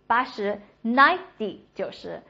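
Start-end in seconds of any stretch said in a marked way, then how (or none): 0.8-1.6 "ninety" as strong "NINE", then light "dee"; stress on NINE. Shown as a demonstration. Teacher drilling tens, stress on the first syllable. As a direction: punch the start of "ninety". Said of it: "重音都是哒哒".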